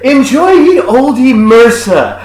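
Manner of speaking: slow, loud, high olde-English parody; playful Renaissance caricature; mock fair wench. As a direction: sing out a hammed-up fair send-off. Parodies fair server's farewell.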